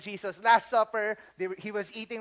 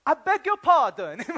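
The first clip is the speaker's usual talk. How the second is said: louder, higher put-on British accent; mock posh accent; 6 semitones higher, 8 dB louder than usual. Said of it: "[name] changing his accent".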